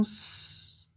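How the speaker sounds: long sustained hiss for "S"; pure held sss on "S"; isolated S sound. Shown as a demonstration. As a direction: say "S" as a drawn-out sss, not the letter name. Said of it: "le son S".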